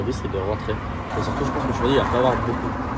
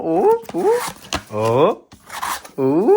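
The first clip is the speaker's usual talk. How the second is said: loud, high rising "oh"; short oohs jumping up in pitch; excited "oh"; 7 semitones higher, 5 dB louder, much slower than usual. Real, not acted. Straining to open his gift. (petits « oh » excités qui montent)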